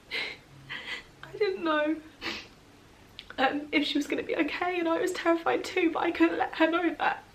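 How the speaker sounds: pushing through the story while crying; real crying. Real, not acted. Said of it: "I'm a mess".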